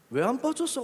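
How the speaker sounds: high-pitched impulsive blurt. Retold or imitated. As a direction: throw the question out before thinking. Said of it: "그냥 일단 질러놨어요". (일단 질러 보는 높은 목소리)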